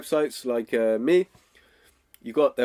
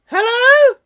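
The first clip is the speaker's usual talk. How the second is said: very high, loud squeaky Hello?; wrong way, squeaky; 12 dB louder than usual. Shown as a demonstration. Coach shows how not to answer a prospect, squeaky and high. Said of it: "you don't go".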